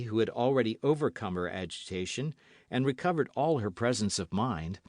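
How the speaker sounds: ordinary delivery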